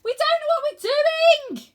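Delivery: very high, loud, slow desperate yell; boy panics aloft on a dragon; panicked yell. Acted. Panicked yelling.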